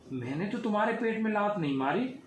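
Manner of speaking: put-on high, innocent little boy voice; innocent child. A narrator's reading. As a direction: explain it plainly like a small child. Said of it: "Ram ने मासूमियत से कहा".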